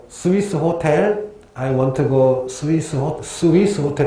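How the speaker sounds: slow, deliberate "스위스 호텔" spelled out; slow careful tourist. Retold or imitated. Tourist asking a Thai local for directions, slow and careful. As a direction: drag the words out for a foreigner. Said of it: "그다음에 내가".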